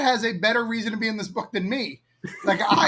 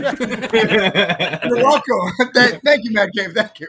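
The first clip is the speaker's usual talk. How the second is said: laughing; 6 dB louder, much slower than usual. Real, not acted. Laughing thanks to Mad Cave.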